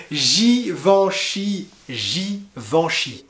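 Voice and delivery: slow the word down for learners; slow model. Shown as a demonstration. Teacher slowly repeating "Givenchy" for learners. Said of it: "repeated slowly".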